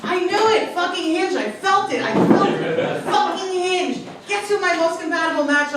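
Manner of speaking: high, loud triumphant cry; excited outburst. Real, not acted. Comic to couple who met on Hinge, excited high shout. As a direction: blurt it out like you called it.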